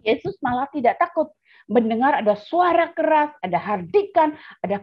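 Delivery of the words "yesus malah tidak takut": normal talking voice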